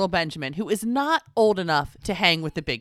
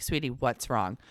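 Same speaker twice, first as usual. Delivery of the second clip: soft, low comforting question; tender mother; 9 semitones lower, 9 dB quieter than usual. Retold or imitated. Mom to crying five-year-old, tender concern. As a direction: drop low and gentle, as to an upset child.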